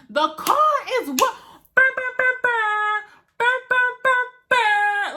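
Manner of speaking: loud high revving car noise; engine noise imitation. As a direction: mimic a sputtering engine starting.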